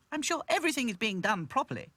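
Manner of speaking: high, loud, confident doll voice; =pitch it high and reassure firmly; Dotty reassuring. Acted.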